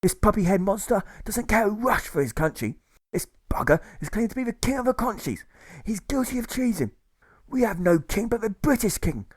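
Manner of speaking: a provost marshal ranting, pitched high; frenzied rant. Acted. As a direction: rail at the prisoner like a zealot. Provost marshal rants against prisoner.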